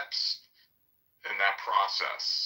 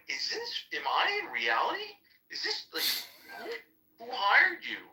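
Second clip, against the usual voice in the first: disbelief. Retold or imitated. Quoting his own disbelief.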